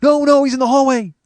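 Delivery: high-pitched, loud mock yell; frantic shout. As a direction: imitate a panicked friend shouting a correction. Friend frantically reassuring angry host.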